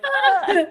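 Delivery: high mock baby cry of "ah"; imitated baby "ah". Mom imitating her baby leaning back, playful high sound. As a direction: mimic a baby's high "ah" with a laugh. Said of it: "She's gonna be like".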